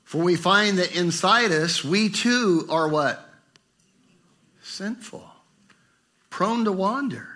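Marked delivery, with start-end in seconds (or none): 4.6-5.3 breathy whispered word. Real, not acted. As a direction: drop to a whisper.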